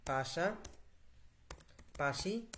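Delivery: পাশাপাশি drawn out very slowly, ending on a rise; slow dictation. Shown as a demonstration. Teacher dictating the word to the app, very slow. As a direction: stretch পাশাপাশি out syllable by syllable for the voice input.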